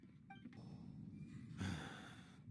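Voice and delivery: long weary breathy sigh; exasperated sigh. Acted. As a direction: sigh out your annoyance. Annoyed at eavesdroppers.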